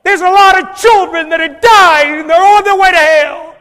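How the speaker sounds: high, shouted pulpit cry; impassioned preacher at the top of his voice; full-force preaching. Real, not acted. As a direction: shout it urgently over the congregation.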